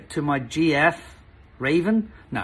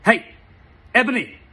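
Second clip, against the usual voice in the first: louder call, split in two; hammed-up shout; mock shout; 7 semitones higher, 5 dB louder than usual. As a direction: call her name loudly. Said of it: "shouted a voice".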